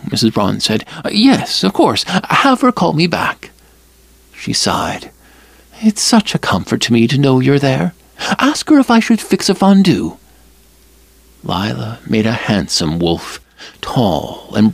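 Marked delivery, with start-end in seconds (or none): 1.1-3.5 higher, chatty mother's invitation; Mrs Braun's voice. Acted. 5.8-10.2 higher, chatty mother's invitation; Mrs Braun's voice. Acted.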